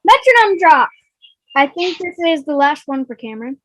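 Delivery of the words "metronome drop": announcer reveal. Acted. Announcing the wheel result.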